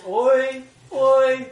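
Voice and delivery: teacher showing the mourners' cry; lament wail. Shown as a demonstration. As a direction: wail it with sorrow. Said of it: "this wail of".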